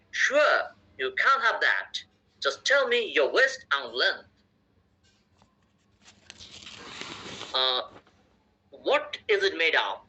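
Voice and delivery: slow, careful American English accent demo; slow American English accent read-aloud. Shown as a demonstration. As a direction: read it slowly in an American accent for students to repeat. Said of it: "দুই অ্যাকসেন্টই আমি উচ্চারণ করবো, আমেরিকানটাও করবো".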